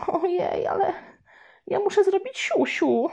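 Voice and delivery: put-on high, anxious little girl squeak; scared little sister. Little sister scared of the spider, needs the toilet, high anxious whine. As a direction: whine it, fidgeting. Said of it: "Zuzia kręciła się niespokojnie".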